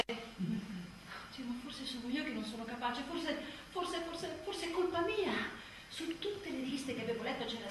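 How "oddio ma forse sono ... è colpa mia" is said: soft, self-doubting murmur; anxious murmur. Acted. Tired new mother blaming herself, softly. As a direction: doubt yourself quietly, almost to yourself.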